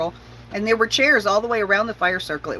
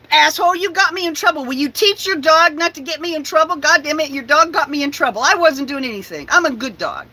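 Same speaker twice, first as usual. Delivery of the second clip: higher, louder grumbling dog voice; dog storming off after being blamed, huffy and raised; indignant dog; 7 semitones higher, 4 dB louder than usual. Retold or imitated. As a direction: complain like a wronged good boy. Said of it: "That's Winston".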